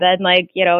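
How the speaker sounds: usual speaking voice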